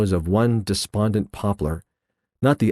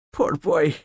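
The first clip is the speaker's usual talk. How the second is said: pitying; 7 semitones higher than usual. A narrator's reading. Scrooge to his former self, pitying. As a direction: feel for the lonely child. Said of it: "said in a pity".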